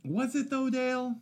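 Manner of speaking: high-pitched mock skepticism; teasing doubt. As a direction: needle your friend in a squeaky put-on voice. Teasing doubt of friend's praise.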